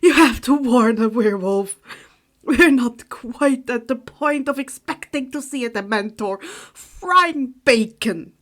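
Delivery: hammed-up fright; dramatic shock. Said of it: "clutching his chest dramatically".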